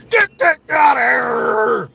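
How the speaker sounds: slow, loud, drawn-out shout; mock shout. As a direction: swat the words away with a big yell. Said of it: "He said".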